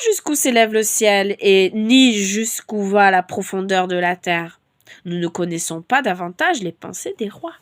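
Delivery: loud, measured reading of the verse; scripture reading. Acted. A preacher reading Proverbs to listeners, solemn reading. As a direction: read the verse out with weight.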